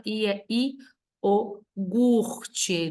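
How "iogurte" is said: "iogurte" broken up slowly, starting io-, then a drawn-out gur-te; careful model pronunciation. Shown as a demonstration. Teacher reminding students of the right form, slow careful "iogurte". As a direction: sound out "iogurte" in separate syllables. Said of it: "não esquece disso".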